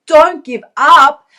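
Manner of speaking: a slow, loud "Don't give up"; rallying cry. Real, not acted. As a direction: punch it out to rally the viewers. Rallying the audience.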